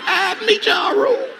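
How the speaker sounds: hammed-up high loud cry; frantic shout.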